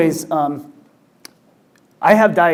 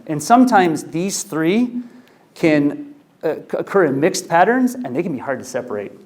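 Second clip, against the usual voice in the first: usual speaking voice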